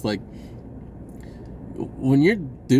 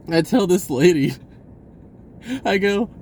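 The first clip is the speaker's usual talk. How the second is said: ordinary talk, nothing marked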